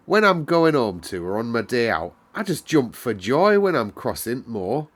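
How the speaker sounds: put-on a cheerful Yorkshire servant girl telling the weather; Yorkshire maid Martha. Housemaid Martha explains the moor weather to Mary, lively Yorkshire lass. Martha praises her mother.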